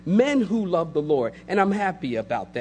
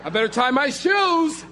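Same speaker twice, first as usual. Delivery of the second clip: mock macho shout; 7 semitones higher than usual.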